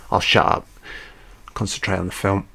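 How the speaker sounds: ordinary delivery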